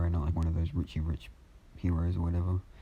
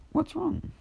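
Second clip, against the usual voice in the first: put-on high little boy asking; small boy. Boy asking the officer, high and worried. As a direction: call it out like a worried child. Child asks the soldier.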